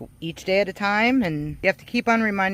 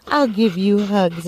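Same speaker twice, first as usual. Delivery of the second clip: cooing to the dog; 2 semitones higher, 4 dB louder than usual. Real, not acted.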